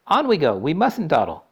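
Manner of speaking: high, brisk showman; Wonka voice. A narrator's reading. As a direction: hurry them along gaily. Wonka urging them on.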